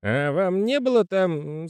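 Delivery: high slow wondering voice; high character voice. Acted. Postman character voice.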